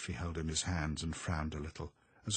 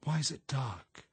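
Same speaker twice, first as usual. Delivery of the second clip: high, anxious question, fully voiced; frightened, high-pitched asking; trying to ask; 7 semitones higher than usual. A narrator's reading. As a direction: plead to know, pitch raised. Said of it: "she tried to ask".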